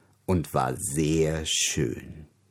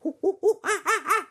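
high squeaky monkey giggle; shrill little ape laugh before reading. Acted. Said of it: "Der Affe las".

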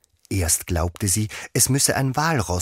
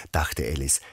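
usual speaking voice

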